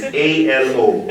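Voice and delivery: A, L, O spelled slowly, letter by letter. Shown as a demonstration.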